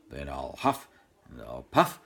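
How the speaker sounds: deep, low wolf growl rising to loud. Acted. Wolf threatening the second pig, deep growl. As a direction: growl it like a big bad wolf. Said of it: "growled in a very loud voice".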